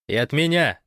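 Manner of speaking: calling out. A narrator's reading. Lyubimov adds his greetings from afar, calling out. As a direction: shout to add your greetings. Adding greetings from another room.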